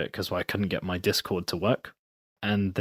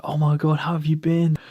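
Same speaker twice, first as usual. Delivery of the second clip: higher and louder, thrilled; excited reunion; 8 semitones higher, 6 dB louder than usual. Retold or imitated. Friend thrilled to hear from old gaming buddy, excited. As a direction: greet a long-lost friend with delight. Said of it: "he just goes ballistic".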